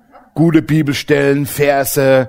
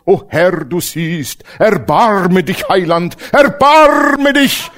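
slow, higher, wailing erbarme; mock wailing prayer; 4 semitones higher, 4 dB louder, much slower than usual. Believers begging God loudly, mock wailing slowly. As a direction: pray in overdone lament. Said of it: "wir gehen manchmal mit Gott so um".

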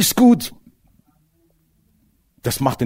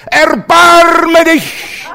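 drawn-out high yell; mock shout; 14 semitones higher, 7 dB louder, much slower than usual. Believers shouting at God, mock high yell. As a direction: scream it slowly at God. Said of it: "je lauter sie schreien".

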